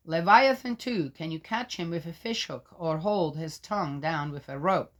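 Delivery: solemn public reading of Job; scripture reading. Acted. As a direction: proclaim it like a lector. Reads scripture.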